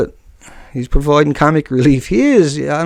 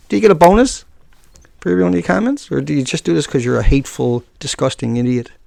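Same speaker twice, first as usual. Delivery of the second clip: ordinary delivery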